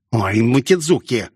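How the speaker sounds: ordinary delivery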